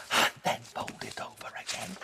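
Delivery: soft whisper. Shown as a demonstration. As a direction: whisper it playfully. Whispered repeat while folding.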